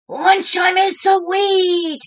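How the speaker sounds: high, loud, slow happy yell; excited yell. A narrator's reading. Clark yelling about lunch, loud and gleeful. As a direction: yell it out with delight. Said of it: "yelled Clark the shark".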